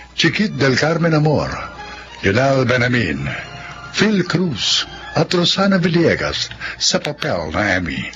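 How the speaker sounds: ordinary talk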